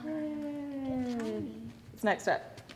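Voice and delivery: ordinary talk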